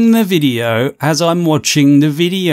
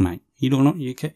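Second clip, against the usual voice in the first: ordinary talk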